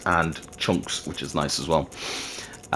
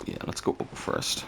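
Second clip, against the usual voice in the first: breathy whisper; 11 dB quieter than usual. Real, not acted. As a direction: whisper it to yourself. Quiet aside while sneaking.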